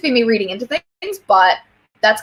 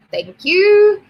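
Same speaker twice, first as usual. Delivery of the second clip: high, louder drawn-out thank-you; playful thanks; 11 semitones higher, 6 dB louder than usual. Real, not acted. Playful repeated thanks to viewers.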